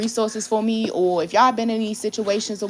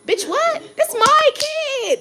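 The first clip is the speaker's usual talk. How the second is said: very high, incredulous cry, louder and stretched at the end; outraged outburst; 6 dB louder, much slower than usual. Real, not acted. Mother answering someone who polices her posts, outraged high shout. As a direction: shoot way up in pitch, then drag out the last words. Outraged reply to being told off.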